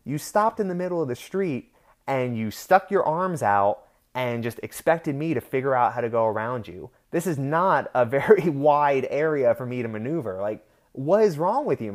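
heated retort, short of a full shout; retold angry yell. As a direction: replay yelling back, exasperated and indignant. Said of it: "I yelled back at him".

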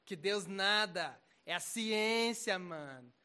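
mild parody of a dismissive unbeliever; mock scoffing atheist. Mocking a smug atheist.

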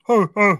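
high, loud sobbing gasps; hammed-up little kid crying; crying child sobs. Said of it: "I didn't know the crying child went".

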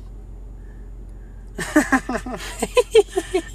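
long breathy fit of laughter. Real, not acted. Podcast host reacting to friend's crude joke, long laughing fit.

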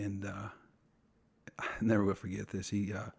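usual speaking voice

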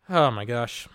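ordinary talk, nothing marked

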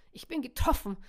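mock-dying gasp. A narrator's reading. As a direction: play a comic hit, gasping. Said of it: "keuchte dieser".

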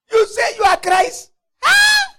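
crying out in scorn, high and loud; mocking shout. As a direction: jeer it out like the thief on the cross. Mocking thief on the cross.

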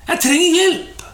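high-pitched plea, only mildly called out; retold cry for help. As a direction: act out calling to God for help, at talking volume. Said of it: "han ropte". (etterlignet rop om hjelp)

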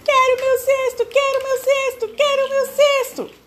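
very high, loud whining monkey cry; hammed-up squeaky monkey wail. A narrator's reading. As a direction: sob like a small monkey who lost everything. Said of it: "Bradou um macaco, gemendo e chorando".

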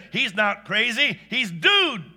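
firm, loud declaration; booming. Acted. Husband defending his horse to the family, booming. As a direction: put your foot down, boom it. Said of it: "Bart boomed".

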